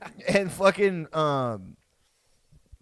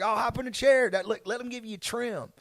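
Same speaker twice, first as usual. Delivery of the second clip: higher, lightly voiced dad brushing it off; coaxing dad; 7 semitones higher than usual. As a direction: imitate a dad waving away the fuss. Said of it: "My dad was like".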